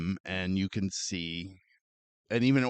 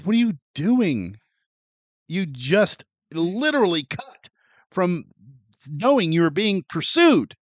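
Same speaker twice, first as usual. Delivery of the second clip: exasperated disbelief; 7 semitones higher, 4 dB louder than usual. Retold or imitated. Reacting to the film.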